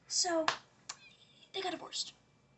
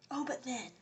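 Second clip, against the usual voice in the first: ordinary talk, nothing marked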